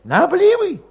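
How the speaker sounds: put-on high, tense young Cossack voice; high-voiced young troublemaker; Valet's voice. A narrator's reading. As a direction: pitch him up high and edgy.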